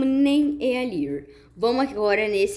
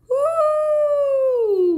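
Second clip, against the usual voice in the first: very high, loud joyful cry; thrilled whoop; 5 dB louder than usual. Real, not acted. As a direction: cry out in delight. Thrilled on the slide. (grito agudo de empolgação)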